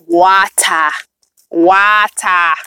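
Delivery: playful Jamaican-style "wa-tah", slow and drawn out; Jamaican accent. Pretend. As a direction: stretch it slowly in a put-on Jamaican accent. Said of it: "I say that like Jamaican".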